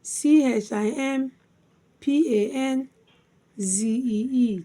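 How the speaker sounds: three letter groups with pauses between; spelled by syllables. Shown as a demonstration. Teacher spelling chimpanzee for learners, syllable by syllable. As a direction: spell it out, lifting the first two chunks. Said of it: "chimpanzee divided into three syllables".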